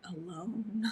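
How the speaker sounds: mock-dramatic fading alone; lonely echo. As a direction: draw out "alone" like a mournful movie line. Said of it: "I love Renee Zellweger".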